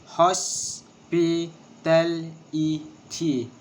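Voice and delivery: "Hospitality" very slowly in separate syllables. Shown as a demonstration. Teacher showing the syllables of hospitality, syllable by syllable. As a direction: break the word up, pausing after each syllable. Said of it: "six syllables".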